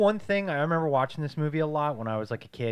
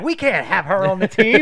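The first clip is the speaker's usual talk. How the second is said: higher, louder put-on scout voice; scout impression; 6 semitones higher, 9 dB louder than usual. Scout turning down a player to her dad, mock dismissive voice. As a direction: reject her as an insulting scout.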